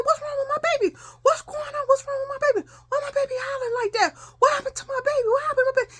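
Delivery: very high, wailing alarm; acted panicked mother. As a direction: fire frantic questions, nearly crying, as a mother. Mother panics hearing child scream.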